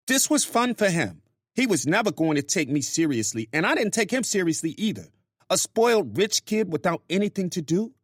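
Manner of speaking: high-pitched woman venting to a friend; Grace's voice. Acted.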